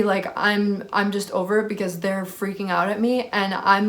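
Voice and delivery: normal talking voice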